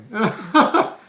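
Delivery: short, loud high burst of laughter. Real, not acted. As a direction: burst out laughing at your own impression. Laughs at own aunt imitation.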